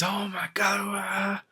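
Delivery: high, strained whisper-scream, drawn out; hammed-up, stifled shriek of rage; mock furious outburst. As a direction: imitate a raging scream without the volume. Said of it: "this dude gets so mad".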